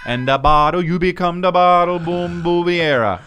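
put-on slow, high Jamaican accent; hammed-up Jamaican accent. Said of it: "like your Jamaican accent".

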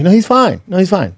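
high, loud covering-up act; mock reassurance. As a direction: insist too eagerly that all is well. Acts out Weekend at Bernie's.

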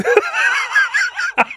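shrieking burst of laughter. Real, not acted. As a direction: cackle at the punchline. Laughing at own punchline.

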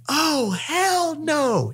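very high, drawn-out put-on voice; mock outraged refusal. Date refusing a weekend guest with a duffel bag, mock outraged. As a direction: stretch it slowly in an exaggerated high pitch.

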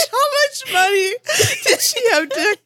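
shrieking through laughter; laughing. Real, not acted. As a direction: ask it while cracking up. Hysterical laughter over misunderstanding.

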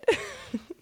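short airy laugh; a quick breathy burst of laughter. Real, not acted. Laughing at her own covid joke, airy laugh.

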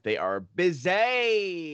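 stretched, rising "busy"; drawn-out emphasis. Real, not acted. Host complaining about crowded Burbank showtimes, drawn-out emphasis. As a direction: drag out the words for emphasis. Stressing how busy theaters are.

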